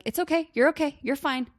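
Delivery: quick high chirpy "you're fine" to wave the feelings away; dismissive reassurance. Retold or imitated. Parent brushing off an upset child, high and dismissive. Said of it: "that really is so dismissive".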